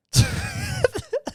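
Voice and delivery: squeaky high laugh; high burst of laughter. Real, not acted. Laugh after the quote.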